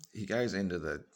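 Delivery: usual speaking voice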